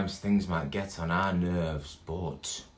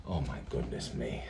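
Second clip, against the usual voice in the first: dismayed; 10 semitones lower, 8 dB quieter than usual. Acted. Narrator's dismayed exclamation.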